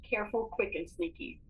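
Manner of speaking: ordinary delivery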